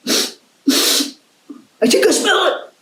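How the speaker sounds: high, slow, airy dog voice; excited dog voice. Acted. Dog character speaks in story.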